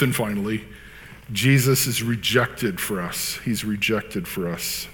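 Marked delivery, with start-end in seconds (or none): none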